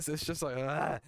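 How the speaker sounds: normal talking voice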